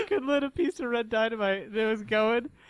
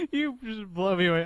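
ordinary talk

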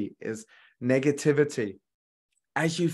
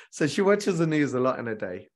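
ordinary talk

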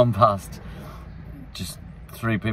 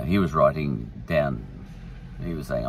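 ordinary talk, nothing marked

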